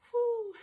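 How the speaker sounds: loud high whoop on the press; effortful whoo. Real, not acted. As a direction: blow out a big high "whoo". Exhaling hard with each press.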